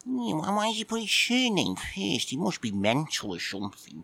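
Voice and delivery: high, squeaky grumpy cat puppet just woken; a sleepy, put-out little puppet admiral; cheeky puppet cat. Acted. Puppet cat grumbling at Mike for waking him, squeaky.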